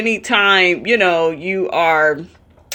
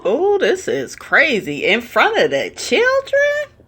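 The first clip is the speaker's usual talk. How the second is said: much higher, squeaking in disbelief; shocked disbelief; 8 semitones higher than usual. Real, not acted. Commentator reacting to a fight in front of kids, squeaky and shocked. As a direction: gasp it out, scandalised.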